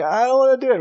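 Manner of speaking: put-on high, whiny scared kid; mock scared whine. Pastor voicing a crying camper, high mock whine.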